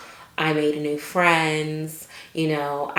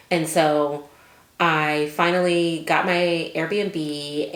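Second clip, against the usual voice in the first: normal talking voice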